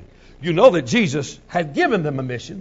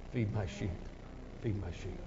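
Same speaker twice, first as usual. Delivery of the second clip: hushed; 8 semitones lower, 20 dB quieter than usual. Acted. Jesus tells Peter, hushed.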